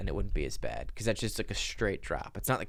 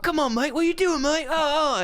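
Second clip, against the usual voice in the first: high, loud Australian accent, exaggerated; mock Australian yell, very high; Australian instructor; 8 dB louder than usual. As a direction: bellow it like a panicked Australian skydiving instructor. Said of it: "I think it's Australian".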